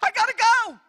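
high, loud, squeaky panic in a put-on voice; hammed-up fearful squeal; mock scared escape. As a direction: play a frightened churchgoer bolting from a gift. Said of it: "would say".

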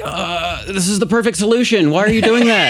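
high, loud disbelieving voice, picking up speed; incredulous mock quote. Mocking venue rules on vaping, high and loud disbelief. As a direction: act baffled that anyone would ban it, voice climbing. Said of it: "And it's like".

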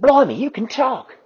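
high, loud astonishment; amazed. Acted. Quasi astonished that the T-Rex can talk. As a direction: gasp it out in wonder.

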